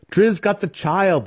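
slow, high boastful drow voice; boasting drow. A narrator's reading. Drow boasting to Dinin about his kill, slow and high. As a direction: gloat over the kill.

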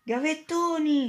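high, slow, drawn-out yell of gavettoni; yelled alarm. A narrator's reading. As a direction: call out gavettoni as a warning to everyone. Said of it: "Poi qualcuno urlò". (urlo alto e lento: gavettoni)

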